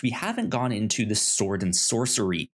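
ordinary talk, nothing marked

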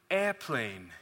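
airplane said the British way, like ɛpʰleɪn with no r. Retold or imitated. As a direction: give airplane a British ring, air without the r. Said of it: "I said the word".

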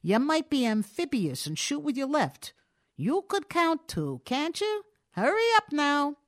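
an impatient burglar's order. A narrator's reading. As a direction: hurry him along at gunpoint. Burglar hurries the citizen.

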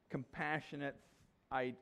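ordinary talk

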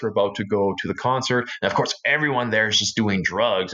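ordinary delivery

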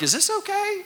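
nervous beginner's high-pitched question. Retold or imitated. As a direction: pipe up like a scared beginner. Anxious novice asking expert.

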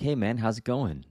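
put-on old-friend familiarity. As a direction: greet them like an old buddy, lightly acted. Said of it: "like they're an old friend".

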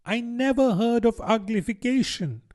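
put-on high-pitched storybook girl; Alice. A narrator's reading. Alice ventures a doubt to the Mock Turtle, high-voiced. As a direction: venture it in a high, level little voice. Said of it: "Alice ventured to say".

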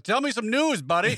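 hammed-up buddy begging for news; mock eager buddy. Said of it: "You're like".

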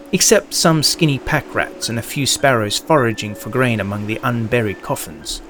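plain reading voice

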